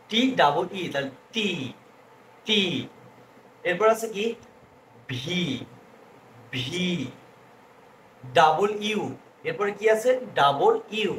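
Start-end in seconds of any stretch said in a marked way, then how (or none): none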